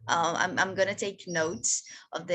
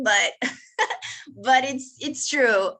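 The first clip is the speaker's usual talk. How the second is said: a laugh that breaks into real tears, louder; choked up; 6 semitones higher, 6 dB louder than usual. Real, not acted. Doctor admits her feelings to the host, tearful. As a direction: voice cracking with real emotion on the last words. Moved while speaking of vulnerability.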